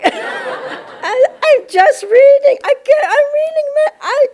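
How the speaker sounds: high and loud, fast and breathless, half laughing; excited. Retold or imitated. Flustered, telling the yogi she's just reading, excitedly. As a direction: blurt it out, overwhelmed and thrilled.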